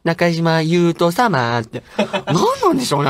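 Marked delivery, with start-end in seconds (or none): 0.1-1.6 中島優斗様 very slowly, in a drawn-out singsong; mimicked hospital call. Host mimicking the clinic as with parents, 中島優斗様 in drawn-out odd intonation. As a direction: imitate a clinic announcement, dragging out 中島優斗様. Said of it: "よく親とモノマネしてましたよ".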